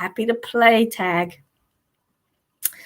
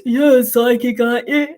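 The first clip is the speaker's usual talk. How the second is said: funny caller voice; 3 dB louder than usual. Retold or imitated. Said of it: "he had this real funny voice".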